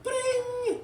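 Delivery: high, loud ringing noise; very high bell imitation; imitated school bell. A narrator's reading. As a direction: sing out a shrill bell ring.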